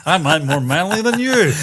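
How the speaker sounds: hammed-up macho voice; mock manly voice. Boasting to his co-host in a mock manly voice. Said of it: "you don't even sound manly saying that".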